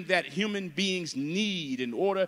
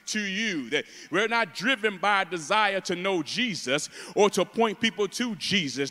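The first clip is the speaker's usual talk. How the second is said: usual speaking voice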